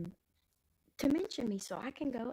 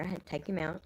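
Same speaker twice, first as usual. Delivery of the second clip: ordinary talk, nothing marked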